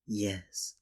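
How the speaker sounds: usual speaking voice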